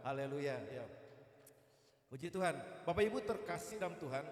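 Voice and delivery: normal talking voice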